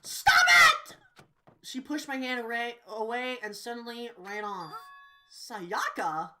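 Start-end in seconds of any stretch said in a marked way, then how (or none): 0.0-1.0 a piercing, very high, loud shriek; screaming stop. Acted. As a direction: push him off with a scream. Sayaka screams at Makoto.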